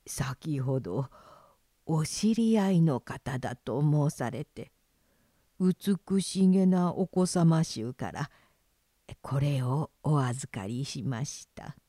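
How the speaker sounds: low, soft and polite; hushed innkeeper. Acted. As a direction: deferential whisper to a guest. Innkeeper hands over a box.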